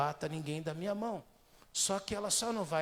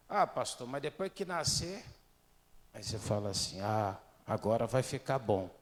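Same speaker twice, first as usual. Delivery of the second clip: normal talking voice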